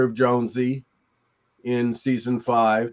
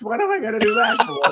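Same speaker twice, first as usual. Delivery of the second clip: squeaky high mock indignation; mock outrage; faster than usual. Joking to the hosts about ignoring the script, squeaky mock outrage. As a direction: fake outrage, pitched way up.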